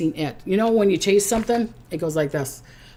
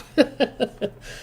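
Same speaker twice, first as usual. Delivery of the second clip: breathy laugh; 6 semitones higher than usual. Real, not acted. Laughing at crab joke.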